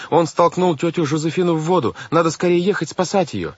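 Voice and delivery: urgent high-pitched accusation; crying out. A narrator's reading. Girl begs the banker to save her aunt, crying out. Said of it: "закричал Вайолет".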